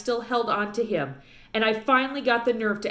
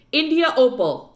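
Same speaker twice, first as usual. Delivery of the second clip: stern warning; 5 semitones higher, 7 dB louder than usual. A narrator's reading. Preacher scolds daughter.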